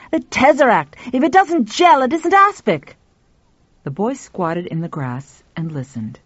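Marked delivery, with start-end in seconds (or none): 0.1-3.0 loud, high-pitched frustrated correction; exasperated. A narrator's reading. Frustrated man correcting the woman's guess, high exasperated voice.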